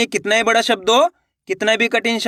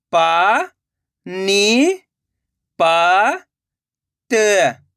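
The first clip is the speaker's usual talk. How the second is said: syllable by syllable; much slower than usual. Shown as a demonstration. Teacher sounding out a word for learners, syllable by syllable. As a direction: separate drawn-out syllables with long gaps. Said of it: "एक एक अक्षर का उच्चारण करके".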